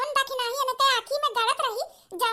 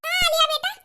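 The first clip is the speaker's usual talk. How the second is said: ordinary talk, nothing marked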